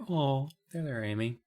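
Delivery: very soft mock consoling; soothing sympathy. Imagined people comforting Amy.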